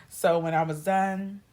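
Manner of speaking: ordinary talk, nothing marked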